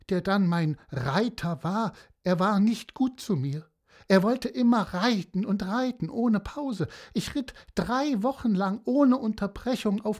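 a hint of a chatty seahorse telling tall tales; storyteller slipping into the seahorse's rambling voice; lightly voiced seahorse. Seahorse rambles to Pieper about its adventure, lightly voiced.

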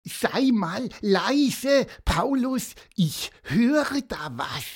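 high, urgent call to hush; Pieper calling out. A narrator's reading. Pieper hushing his friend Paulus, high urgent call. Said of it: "als Pieper plötzlich rief".

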